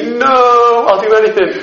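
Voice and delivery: hammed-up panicked begging; mock pleading. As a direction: beg in playful, exaggerated desperation. Mimics her pleading against threat.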